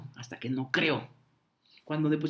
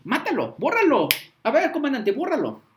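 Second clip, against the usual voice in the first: high, loud angry yelling; mob shout; 11 semitones higher, 7 dB louder than usual. Retold or imitated. Crowd yelling at the commander to kill Paul, high angry shouts. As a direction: scream for his death. Mob demands Paul's death.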